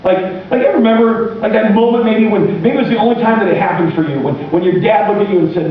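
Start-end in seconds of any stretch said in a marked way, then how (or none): none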